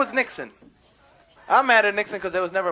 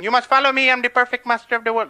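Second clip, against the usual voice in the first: loud, raised mock guru proclamation; preachy caricature guru, higher voice; Guru Maharaji; 7 semitones higher, 7 dB louder than usual. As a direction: proclaim yourself master in accented sing-song. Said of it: "he comes out with".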